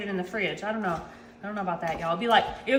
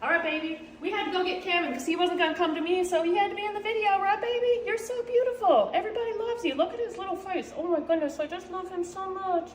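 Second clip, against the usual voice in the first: doting pet talk; 5 semitones higher, 4 dB quieter than usual. Real, not acted. Mom coos softly to her dog Kevin, high baby talk.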